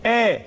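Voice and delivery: آ with a firm glottal attack; crisp onset on آ; strong hamza. Shown as a demonstration. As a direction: hit the hamza of آ cleanly. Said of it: "إذن الهمزة اللي فيها قوة".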